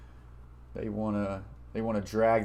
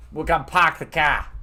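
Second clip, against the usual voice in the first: a light Boston accent, dropped Rs; lightly put-on Boston accent, half joking; 3 semitones higher, 5 dB louder than usual. Shown as a demonstration. Streamer showing chat his Boston accent, lightly put on. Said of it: "I even try to stream with a Boston accent".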